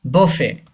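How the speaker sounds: "buffet" said as bo-fay; silent T. Shown as a demonstration. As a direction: end "buffet" on the vowel, no T. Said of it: "Say this word". ("buffet" में टी silent, "बोफ़े")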